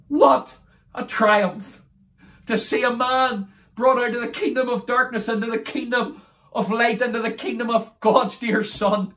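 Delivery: exultant gospel testimony; triumphant preaching. Real, not acted. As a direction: preach the victory with conviction. Exults over a conversion.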